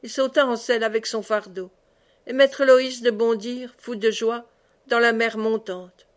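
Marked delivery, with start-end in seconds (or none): none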